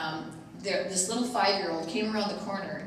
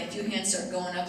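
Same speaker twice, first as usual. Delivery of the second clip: usual speaking voice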